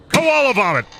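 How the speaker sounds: squeaky high shouted punchline; spring the punchline with glee; high goofy punchline. Pretend. Punchline in character voice.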